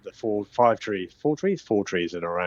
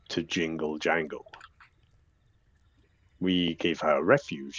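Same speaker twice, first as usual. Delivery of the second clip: deep, unhurried nature spirit; ancient hill creature; 4 semitones lower, 6 dB quieter than usual. Acted. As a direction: speak softly and ponderously, with long pauses. Game master voices the hill.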